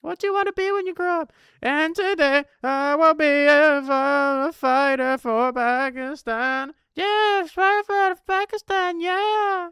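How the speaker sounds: put-on very high, loud squeaky kid voice declaring dreams. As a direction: pipe it up high like an eager child. Acting a kid's ambitions for Pakistan.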